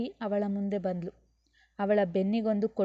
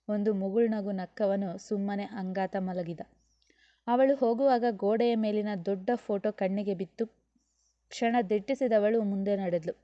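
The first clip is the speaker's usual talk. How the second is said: plain reading voice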